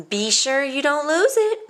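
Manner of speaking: high, loud warning. A narrator's reading. Jim warning Violet not to lose her homework, loud and high. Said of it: "warned Jim".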